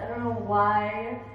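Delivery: usual speaking voice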